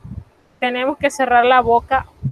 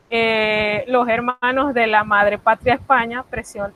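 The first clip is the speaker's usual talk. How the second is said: ordinary delivery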